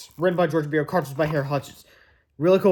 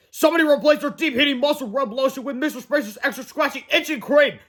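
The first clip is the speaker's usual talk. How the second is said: much higher angry complaint; furious coach; 7 semitones higher than usual. Acted. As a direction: bark out the accusation. Coach accusing.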